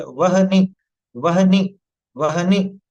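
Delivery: वहनि with a full ह sound, then न, said slowly; practice pronunciation. Shown as a demonstration. Teacher showing the class an easier way to say it, full and careful. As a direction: say वहनि with the ह fully voiced. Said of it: "इसको ऐसे बोले".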